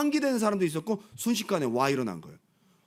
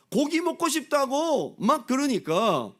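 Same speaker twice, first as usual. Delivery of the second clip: whiny grumbling; 8 semitones higher, 4 dB louder, slower than usual. Retold or imitated. People grumbling for meat.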